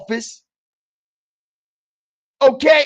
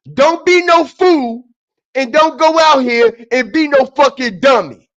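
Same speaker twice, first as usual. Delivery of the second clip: ordinary delivery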